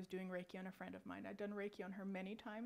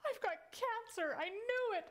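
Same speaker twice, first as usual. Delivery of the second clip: high-pitched panicked outburst, imitated; hammed-up cry of alarm; alarmed friend; 14 semitones higher, 4 dB louder than usual. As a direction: blurt it out high, as if bolting upright. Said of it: "she sat straight up. She's like".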